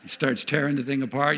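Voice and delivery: normal talking voice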